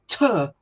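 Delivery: "ter" said with a dropped vowel, Mockney style. Shown as a demonstration. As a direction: clip "ter" like a mock Cockney.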